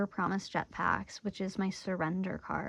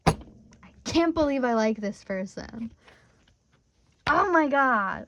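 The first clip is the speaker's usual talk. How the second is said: slow, louder exasperation building to a higher cry; frustrated crush; 3 semitones higher, 6 dB louder, slower than usual. Retold or imitated. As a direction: drag it out, then burst higher. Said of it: "the frog person is like".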